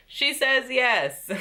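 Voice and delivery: high, slow and gleeful; excited reveal. Retold or imitated. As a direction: drag out the good news with delight. Recapping the proposal answer.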